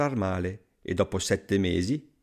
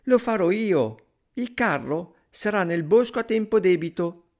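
put-on high, eager little-boy voice; volunteer brightly in a tiny high voice; Pollicino; 8 semitones higher, 4 dB louder than usual. A narrator's reading. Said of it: "esclamò Pollicino". (vocina acuta ed entusiasta)

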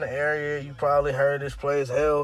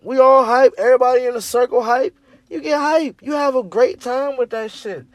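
excited; 6 semitones higher, 5 dB louder than usual. Acted. Hype man describing the party.

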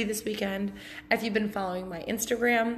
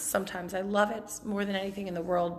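ordinary talk

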